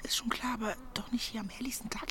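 whispered rebuke; hushed scolding. Acted. Woman hissing at neighbours eavesdropping in daylight, whispered. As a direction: scold them without being overheard.

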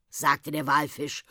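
usual speaking voice

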